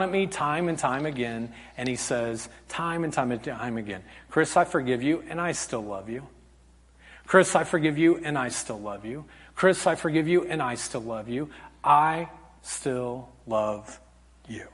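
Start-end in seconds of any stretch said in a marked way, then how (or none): 4.3-11.5 soft, fading low at each phrase end; tender forgiveness. Retold or imitated. God forgiving the preacher time and again, tender. 11.8-14.7 low, soft, drawn-out words; slow, deliberate tenderness. Retold or imitated. God assuring the preacher of lasting love, slow and tender. God's love, final emphatic repeat.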